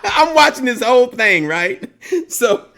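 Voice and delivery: ordinary delivery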